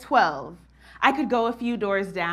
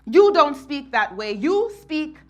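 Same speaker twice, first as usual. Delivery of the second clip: loud, high rebuke from the aunt; aunt scolding her niece for speaking Patois; stern scolding aunt; 6 semitones higher, 7 dB louder than usual. Retold or imitated. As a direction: scold the girl for her accent.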